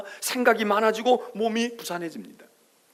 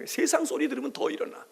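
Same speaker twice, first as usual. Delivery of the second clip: normal talking voice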